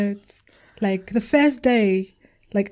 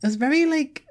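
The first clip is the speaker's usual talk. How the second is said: ordinary talk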